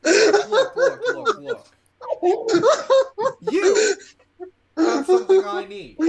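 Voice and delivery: high, loud, insistent outburst; loud in-character demand. Acted. Player confronting someone at the game table, loud demand.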